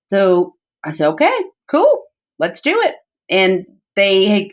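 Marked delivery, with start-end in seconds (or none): 1.1-2.9 high, chirpy okay; bright eager yes to the child; eager agreement. Retold or imitated. Mother agreeing to the pageant, high and chirpy.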